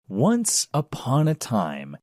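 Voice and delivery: plain reading voice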